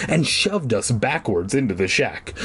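ordinary delivery, nothing marked

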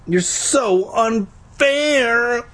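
put-on boy wailing at the unfairness, childlike whine. A narrator's reading. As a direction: stretch it out like a sulking baby. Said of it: "I wailed".

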